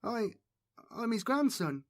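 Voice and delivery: timid high stutter. A narrator's reading. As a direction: stammer it out, scared. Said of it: "Bass stuttered".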